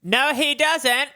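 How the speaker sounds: high-pitched put-on prince voice; high princely contradiction. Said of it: "says the prince".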